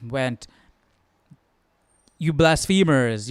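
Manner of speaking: ordinary talk, nothing marked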